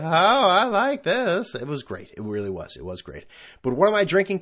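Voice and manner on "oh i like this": savouring. Retold or imitated. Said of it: "I said".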